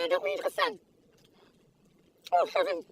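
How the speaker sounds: ordinary delivery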